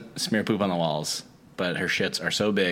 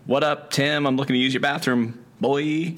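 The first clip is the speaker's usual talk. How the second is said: higher, cocky street-casual greeting; brash customer; 6 semitones higher than usual. Retold or imitated. Customer demanding the bathroom from the clerk, higher cocky voice. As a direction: swagger in like you own the place. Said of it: "this woman comes in and goes".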